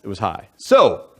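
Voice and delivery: normal talking voice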